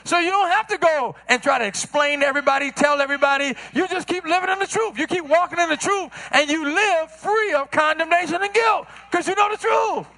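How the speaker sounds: climbing sermon cadence; preaching. Acted. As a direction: lift the congregation with rising conviction.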